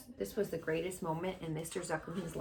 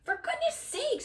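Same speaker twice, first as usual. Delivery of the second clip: put-on very high exasperated old farmer voice; drenched exclamation. Drenched farmer scolds Lurvy, high exasperated squawk. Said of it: "said Mister Zuckerman".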